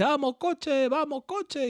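put-on high child voice chanting at the car; toddler cheering. Son cheering the toy car, high childlike chant. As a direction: chant it like an excited little kid. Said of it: "mira, dice".

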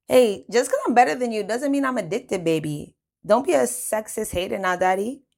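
high, loud, sassy comeback; flirty mock protest with big swings in pitch; teasing. A narrator's reading. French answers Moneyman's gambling jibe, loud teasing. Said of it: "she teased".

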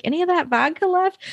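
squeaky high-pitched request; high squeaky ask. Retold or imitated. Jocelyn asks, retold.

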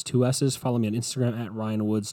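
usual speaking voice

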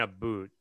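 "a boot" for "about", with an oo vowel. Retold or imitated. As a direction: say "a boot" slowly, rhyming with hoot. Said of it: "what Canadians say".

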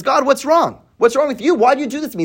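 half-acted Cain demanding answers; mock accusing cry.

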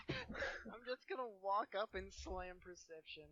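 amused, breaking up while talking; cracking up at a friend's plan; laughing. Real, not acted. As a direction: repeat it through real laughter.

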